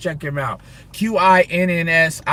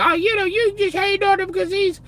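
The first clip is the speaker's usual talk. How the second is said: much higher put-on voice of a critic; high mocking whine; mocking accuser voice; 12 semitones higher than usual. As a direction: squeak it out like a whiny accuser. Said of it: "Could you imagine somebody".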